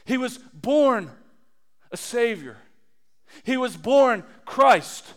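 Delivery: slow, leaning hard on "born"; stress on born. Real, not acted. Preacher declaring Christ's birth to the congregation, slow and emphatic. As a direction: hammer the word "born".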